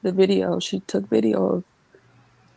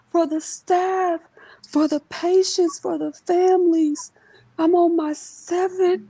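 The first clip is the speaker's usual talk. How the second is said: mother's voice; 12 semitones higher than usual. Retold or imitated. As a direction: voice mom's tearful devotion. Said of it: "She said".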